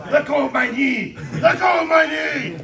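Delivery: slow, high, loud laughing yell; laughing mock shout. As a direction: yell it half laughing, hamming up the pain. Pinned, yelling at partner playfully.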